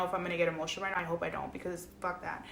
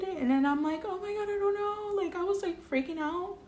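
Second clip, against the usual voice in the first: real tearful retelling, pitch rising into a choked squeal; getting emotional; 5 semitones higher than usual. Real, not acted. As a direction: voice climbing high as tears take over. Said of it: "I'm gonna get emotional".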